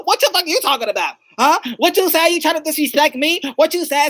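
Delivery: loud, high offended challenge; hammed-up outrage. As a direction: fire back at a crude pickup line. Acted offended woman answers pickup line.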